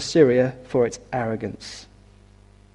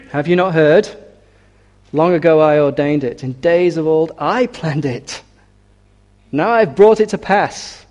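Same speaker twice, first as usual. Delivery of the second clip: forceful pulpit reading, rising at the end; declaimed divine rebuke; 2 semitones higher, 6 dB louder than usual. Acted. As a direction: proclaim it with God's authority. Reads God's rebuke from scripture.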